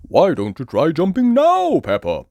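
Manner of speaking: lightly voiced jolly dad; a hint of a cheerful father; Daddy Pig. A narrator's reading.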